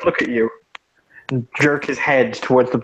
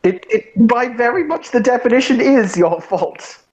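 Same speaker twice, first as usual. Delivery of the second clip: indignant; 9 semitones higher than usual. Acted. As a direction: spell out the blame. Cat argues indignantly.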